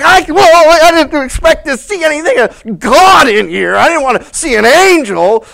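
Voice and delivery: high, loud, frightened protest; panicked priest. Acted. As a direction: babble in alarm. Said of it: "And he's like".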